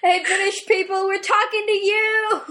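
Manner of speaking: high, loud playful holler; mock calling out. Co-host hailing British listeners in jest, mock calling out. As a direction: hail distant listeners, stretched and high-pitched.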